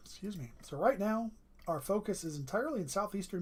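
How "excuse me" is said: normal talking voice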